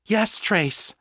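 high, urgent reply. A narrator's reading. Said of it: "she sent back urgently".

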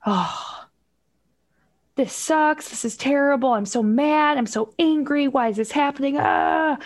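a parent hearing school shut again, exasperated; mock exasperation. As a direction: vent the frustration in a put-on parent voice. Voicing parent's reaction to shutdown.

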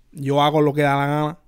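rolled Spanish title, quick; Puerto Rican accent. Shown as a demonstration. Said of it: "I like how you said that".

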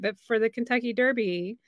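ordinary delivery

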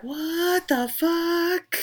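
high, drawn-out stunned what-the-fuck; a friend's stunned reaction, voiced high and slow; stunned disbelief. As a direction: let the words stretch out, shocked. Gwendolyn stunned face voiced.

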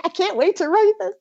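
gleeful, eager inner voice; bubbling over about the new book; excited. Retold or imitated. Author quoting her own excitement, eager. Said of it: "I'm like".

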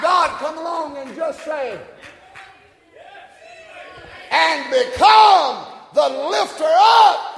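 loud, high preaching shout that builds; full-force preaching. Real, not acted.

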